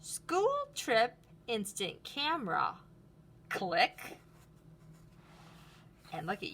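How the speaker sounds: lively storybook read-aloud; reading to children. Acted. Teacher reading Luna's story to the class, lively. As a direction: read it brightly for a class of kids. Reads picture book aloud.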